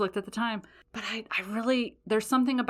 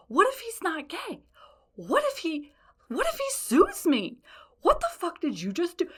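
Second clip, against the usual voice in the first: much higher, frantic self-questioning; squeaky worried what-ifs; panicked inner voice; 10 semitones higher than usual. Retold or imitated. Panicked inner monologue retold.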